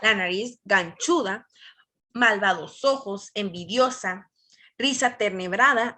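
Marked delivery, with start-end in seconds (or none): none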